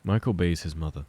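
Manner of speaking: ordinary delivery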